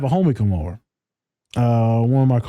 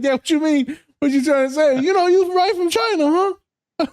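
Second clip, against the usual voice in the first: much higher, teasing put-on voice; mock dad voice. Son imitating dad quizzing the Chinese guest, high voice. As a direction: play the dad, pitched way up. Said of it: "I was like".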